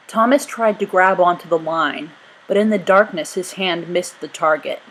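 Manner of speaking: plain reading voice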